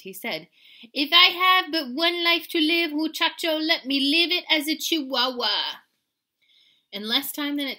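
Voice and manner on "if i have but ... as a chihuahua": put-on loud, high theatrical Spanish accent. A narrator's reading. Kitty to the mirror, loud mock Spanish accent. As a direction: declaim grandly with a Spanish accent. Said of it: "using his very best Spanish accent".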